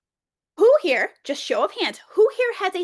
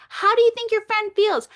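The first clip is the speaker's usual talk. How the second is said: put-on teacher to child; 7 semitones higher, 5 dB louder than usual. Talking to a child.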